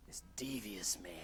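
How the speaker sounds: conspiratorial whisper. Real, not acted. Storyteller whispering about sly teacher, low whisper.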